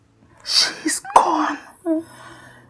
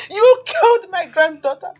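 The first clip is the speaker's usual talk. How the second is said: raised, anguished cry; anguished accusation; 4 semitones higher, 3 dB louder than usual. Acted. Grandmother blaming him for granddaughter's death, raised anguished voice. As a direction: throw the blame at him.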